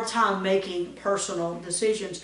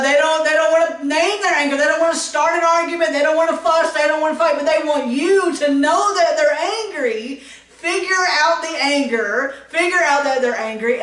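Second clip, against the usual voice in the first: higher, louder and fast; animated rant about angry nines; worked up; 7 semitones higher, 4 dB louder than usual. Acted. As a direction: get worked up acting it out. Acting out angry nine.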